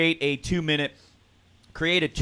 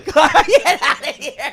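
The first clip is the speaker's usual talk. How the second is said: laughing mock dismissal; 11 dB louder, slower than usual. Teasing friend at movie confession.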